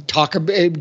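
usual speaking voice

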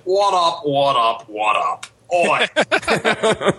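put-on Australian greeting; hammed-up Aussie call-out, ending on a high "Oi"; fake Australian accent. Podcast guest greeting the panel in a fake Australian accent. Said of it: "my fake Australian accent".

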